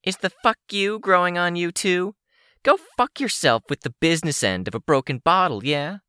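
high-pitched, hostile comeback; high, scornful character voice; angry retort. A narrator's reading. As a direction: fire back in a high villainess voice. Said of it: "Sidepiece retorted".

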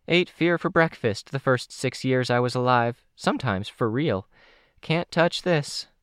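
high-voiced cocky kid; boastful young character. Candy brags to the group about fearlessness, high and cocky.

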